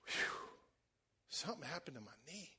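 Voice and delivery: soft, breathy-quiet and awed; awed hush. Retold or imitated. Healed woman telling them softly, awed, about her knee. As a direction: let it out soft, stunned. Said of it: "And she goes".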